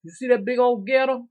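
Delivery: loud, excited call about the gator; daddy calling out. Retold or imitated. Daddy loudly showing off a big gator. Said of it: "he said".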